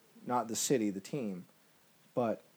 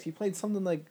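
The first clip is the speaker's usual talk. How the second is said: ordinary delivery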